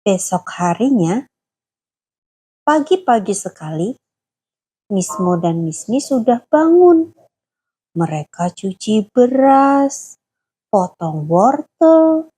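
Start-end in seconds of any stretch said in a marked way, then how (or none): none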